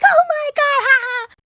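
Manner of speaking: very high squealed fake laugh; mocking hysterical laughter. Imitating two girls laughing at her as she leaves, mock hysterical squeal. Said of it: "them fucking hysterically laughing".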